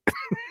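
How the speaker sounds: high-pitched helpless laugh; real hard laughter. Real, not acted. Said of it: "I'm laughing so hard".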